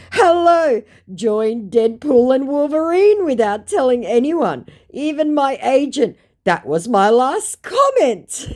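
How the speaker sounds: very high, loud, hammed-up delivery; mock scammer message. Muppet scammer came back with this, hammed-up. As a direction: proclaim it in an exaggerated put-on voice.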